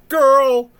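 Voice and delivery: put-on high, loud call of "Girl!"; surprised doctor shouting the news; announcing shout. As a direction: belt it across the delivery room. Doctors announce second twin.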